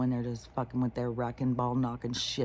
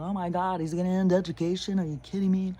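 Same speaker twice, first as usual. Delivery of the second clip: higher, hammed-up panicked outcry; mocking people freaking out, fast; 5 semitones higher, 3 dB louder, faster than usual. People panicking over education news, mock alarmed outcry. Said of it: "I bet you still have the people freaking out".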